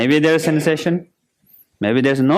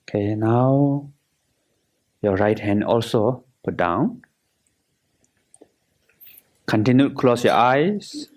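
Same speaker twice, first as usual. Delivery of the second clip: guided meditation. Acted. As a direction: guide them through relaxing, unhurried and measured.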